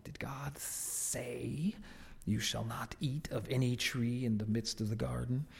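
slow, soft serpent voice, drawn out and hissing; snake voice. Acted. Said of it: "I'll do the snake voice".

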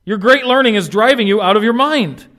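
Festus bellowing at Paul across the court; loud voice. Retold or imitated. Governor shouting that Paul is mad. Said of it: "Festus said with a loud voice".

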